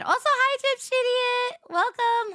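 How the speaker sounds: high, excited welcome to a viewer; chirpy high hello to the chat; bright greeting. Real, not acted. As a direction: sing out a welcome to a new viewer.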